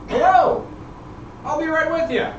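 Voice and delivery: very high, loud sing-song greeting; cheerful showman. Acted. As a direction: call out brightly to the new guests. GM voices showman host.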